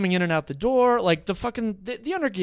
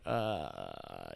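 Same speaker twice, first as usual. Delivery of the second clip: low, soft embarrassed hesitation; 14 dB quieter than usual. Retold or imitated. Kid caught with his own beer, embarrassed. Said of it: "he gets all embarrassed".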